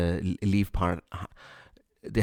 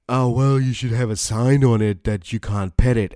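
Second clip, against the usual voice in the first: mock complaining; 4 dB louder than usual. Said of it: "She started complaining that".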